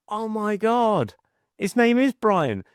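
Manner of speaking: high, drawn-out retold astonishment; amazed. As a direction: imitate a daughter's slow, high-pitched disbelief. Retold daughter amazed at correct guess.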